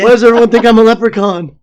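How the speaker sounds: loud, high mock-Irish voice; hammed-up Irish leprechaun complaint; joking Irish accent with laughter; put-on Irish accent.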